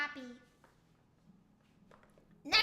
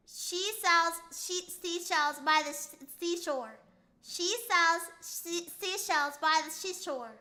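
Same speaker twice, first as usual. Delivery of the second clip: tongue twister attempt; slower than usual. Shown as a demonstration. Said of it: "The one I can't do is".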